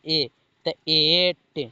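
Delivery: teacher modelling एट syllable by syllable; slow demo reading. Shown as a demonstration. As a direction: sound out एट slowly for the class. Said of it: "तो ई के क्या पढ़ना है?".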